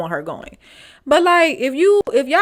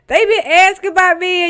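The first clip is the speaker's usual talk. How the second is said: indignant retort; 9 semitones higher, 6 dB louder than usual. Retold or imitated. Confronting ex about his friends.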